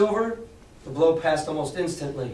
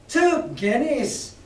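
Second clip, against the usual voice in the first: high, slow outraged cry; roared; 9 semitones higher, much slower than usual. Acted. Pirate Mary roaring at Silver, drawn out and high. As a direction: bellow it in disbelief. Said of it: "roared Mary".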